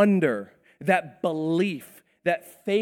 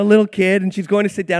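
ordinary delivery

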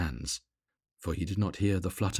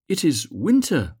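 high, louder bird's voice, pleading; eager little swallow; 8 semitones higher, 6 dB louder than usual. A narrator's reading. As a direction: speak up as the small eager swallow.

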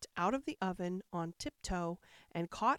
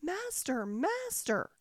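high cry for its master; harp calling out; 8 semitones higher, slower than usual. A narrator's reading. Golden harp calls its master as it is stolen, high. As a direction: cry out for help, high and urgent. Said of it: "called out quite loud".

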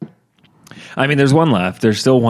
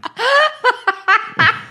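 high burst of laughter. Real, not acted.

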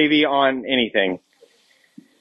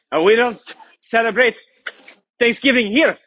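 put-on halting, higher-pitched French accent; mock French accent; 4 semitones higher than usual. Youth leader jokingly imitating a French family, mock French accent. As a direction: put on a playful French accent, pausing between phrases. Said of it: "was that good a French accent?".

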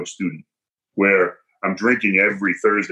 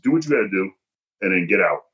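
normal talking voice

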